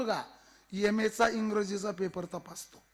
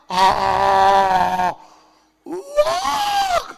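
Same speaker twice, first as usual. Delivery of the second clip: screaming alarm; 13 dB louder, much slower than usual. Acted. Second villager sighting the tiger, screaming. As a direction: draw out a terrified warning scream. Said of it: "काय ओरडतो रे?".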